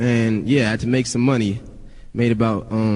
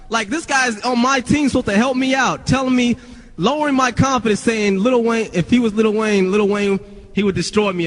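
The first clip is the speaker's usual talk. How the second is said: worked-up indignation; 8 semitones higher than usual. Real, not acted. Indignant retelling of the insult.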